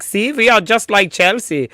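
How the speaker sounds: a clumsy Dutch impression of a club boasting; lightly done Dutch accent; bad Dutch accent. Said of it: "That is the worst Dutch accent I've ever heard".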